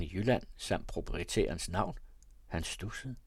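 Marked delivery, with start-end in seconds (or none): none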